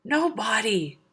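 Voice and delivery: a high, pained shout. Acted. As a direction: cry it out in agony. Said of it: "Polyphemus shouted".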